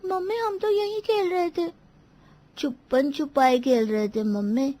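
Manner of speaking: put-on voice of a child; child's voice. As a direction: answer mummy like an innocent kid. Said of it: "अब बच्चों ने बताया". (बच्चे जैसी आवाज़)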